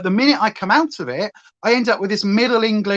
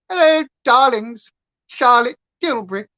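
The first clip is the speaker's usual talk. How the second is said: higher, posh-mimicking Queen accent greeting; mock Queen accent; 4 semitones higher than usual. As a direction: play Charlotte Tilbury in a mock Queen accent. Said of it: "really mock Queen accent".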